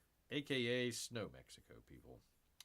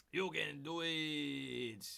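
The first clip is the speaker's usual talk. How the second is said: slow encouraging; 4 dB quieter, much slower than usual. Acted.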